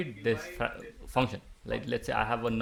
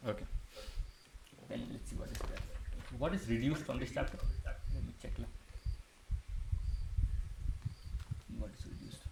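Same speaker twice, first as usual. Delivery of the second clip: whispering; 9 dB quieter than usual. Real, not acted. Teacher checks syllabus to himself.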